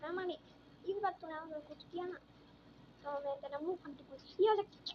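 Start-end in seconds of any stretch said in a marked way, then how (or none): 4.4-4.9 loud comic ripping sound effect; voiced slapstick tearing sound; mock ripping noise. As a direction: shout a cartoon rip noise. Acting a mouth being ripped.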